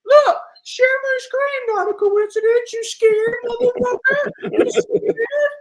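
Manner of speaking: mocking impression. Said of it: "You sound like the character from Shark Tales".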